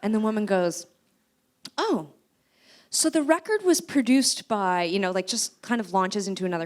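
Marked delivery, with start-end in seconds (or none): 1.8-4.8 higher, louder and slow; breezy interviewer. Retold or imitated. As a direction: press on cheerfully. Interviewer pressing on.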